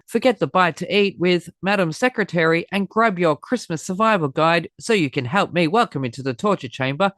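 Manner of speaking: ordinary talk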